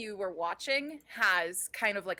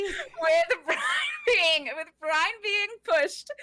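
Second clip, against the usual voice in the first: laughing shout; 10 semitones higher, 6 dB louder than usual. Real, not acted. DM laughing loud.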